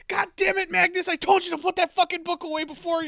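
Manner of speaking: high, loud dinner-table yelling; furious father scolding. Retold or imitated. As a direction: tell him off like an angry dad. Emperor scolding son at dinner.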